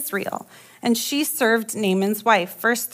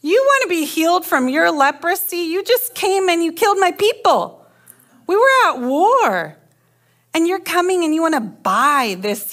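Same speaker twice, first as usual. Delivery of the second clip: higher pitched, indignant king ranting at Naaman; exasperated king's tirade; mock outraged king; 5 semitones higher than usual. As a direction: play the king fuming at the nerve of it, a bit hammed up. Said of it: "He's like".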